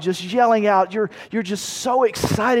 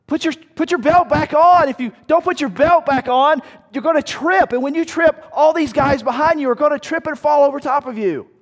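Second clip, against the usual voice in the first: higher, louder pleading shout; urgent yelling; 8 semitones higher, 5 dB louder than usual. Retold or imitated. Soldier warning comrade he will trip, higher urgent shout. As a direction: warn him hard over the noise. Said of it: "You get".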